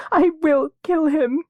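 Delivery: put-on sobbing girl vowing revenge; choked. A narrator's reading. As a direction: choke it out through sobs. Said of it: "she choked".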